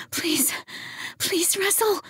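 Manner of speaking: high, breathless pleading whisper; begging woman. A narrator's reading. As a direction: plead with him, desperate. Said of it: "she begged".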